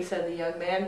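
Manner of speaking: ordinary delivery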